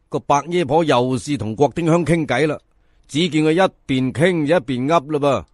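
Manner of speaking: plain reading voice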